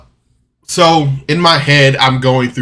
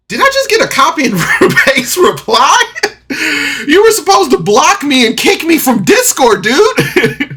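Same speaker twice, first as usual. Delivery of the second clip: much higher, louder, put-on voice with a laugh; mock indignant reply; 14 semitones higher, 4 dB louder than usual. Retelling his reply to the rejection in a mock high voice. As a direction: squeak it out in a joking huff.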